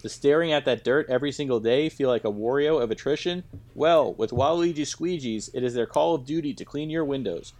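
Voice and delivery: hammed-up commercial pitch for squeegees; mock ad read. Host reading a fake sponsor spot, upbeat ad voice. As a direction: sell it like a TV ad.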